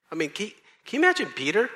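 normal talking voice

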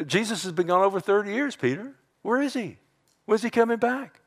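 high-pitched jeering complaint; mocking. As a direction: pester Peter as a disbelieving scoffer. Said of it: "In these mockers".

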